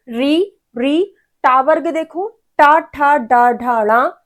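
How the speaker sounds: री, टा, ठा, डा, ढा, णा said with the tongue curled back; retroflex demo. Shown as a demonstration. Teacher models retroflex sounds for students, articulated. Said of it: "तो बोल के देखिए".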